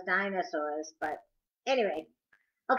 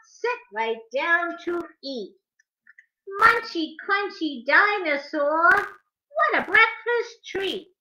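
lively, sing-song picture book rhyme, higher; reading to children; 6 semitones higher, 4 dB louder, slower than usual. Acted. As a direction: bounce the rhymes for little listeners.

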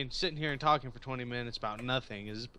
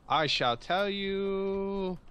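mock grand; 7 semitones higher, 4 dB louder, much slower than usual. Mock grand phrasing, slow.

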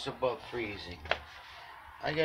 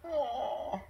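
ordinary delivery

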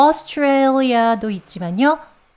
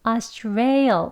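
Australia with stress on rail; Australia slowed, leaning on rail; stress demo; much slower than usual. Shown as a demonstration. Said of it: "강세를 주셔서".

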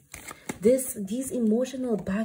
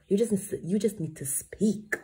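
ordinary talk, nothing marked

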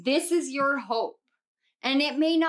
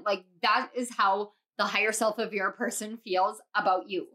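usual speaking voice

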